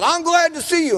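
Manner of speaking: high-pitched mock preacher's welcome; greeting preacher. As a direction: sing out an effusive greeting, imitating a preacher. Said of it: "when the preacher said".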